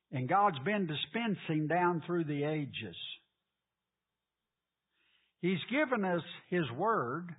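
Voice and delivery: loud fervent preaching. Acted. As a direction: preach it with rising fire. Preaching builds to full force.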